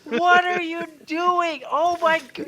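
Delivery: high, drawn-out wail of disbelief; exasperated wail. Real, not acted. Viewer watching a bad window scene, exasperated wail. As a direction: wail it slowly up high, breaking into laughter. Horrified reaction to the show.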